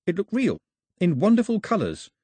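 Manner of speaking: ordinary delivery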